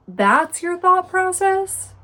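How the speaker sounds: high, loud, drawn-out disbelief; incredulous. Real, not acted. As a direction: question it slowly, appalled and high-pitched. Incredulous reaction to character's thinking.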